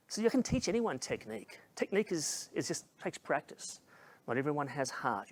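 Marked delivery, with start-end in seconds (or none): none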